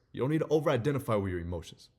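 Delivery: ordinary talk, nothing marked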